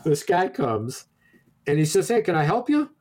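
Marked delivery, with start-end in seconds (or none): 2.1-2.9 clerk greeting. Retold or imitated. Second clerk voiced high.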